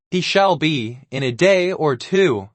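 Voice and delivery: ordinary delivery, nothing marked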